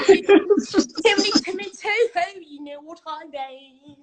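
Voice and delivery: laughing. Real, not acted. Recalling her role in Oliver Twist.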